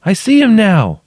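high, loud call from below. A narrator's reading. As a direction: call up what you have just seen. Trapped woman calling up.

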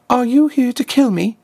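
put-on a helpless girl expecting death; frightened trapped girl. Trapped girl asks the stranger if she came to kill her, tense and high.

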